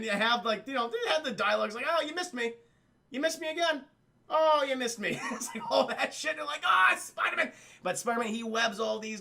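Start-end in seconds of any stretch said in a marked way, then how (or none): 1.9-5.2 high-pitched teasing dialogue; mock taunting. Acting taunts during laser dodging. 6.7-7.5 high, loud comic panic; mock alarm. Acting robbers spotting Spider-Man.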